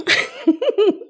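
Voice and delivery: high, bursting laugh at her own accent; burst of laughter. Real, not acted. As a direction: crack up laughing at yourself.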